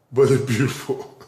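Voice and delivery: ordinary talk, nothing marked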